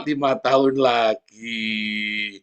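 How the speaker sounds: very slow, drawn-out deadpan answer; mother teasing her child with a slow deadpan reply. Retold or imitated. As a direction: stretch each word, teasing.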